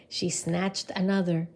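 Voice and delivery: ordinary delivery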